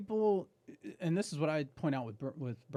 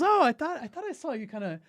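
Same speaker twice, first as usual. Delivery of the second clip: wife's voice; 12 semitones higher, 6 dB louder than usual. Retold or imitated. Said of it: "She goes".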